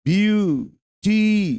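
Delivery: Beautiful drawn out slowly in three parts, ब्यू-टी-फुल; syllable-by-syllable. Shown as a demonstration. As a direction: break beautiful into three slow, separate syllables. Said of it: "तीन syllable हो गया".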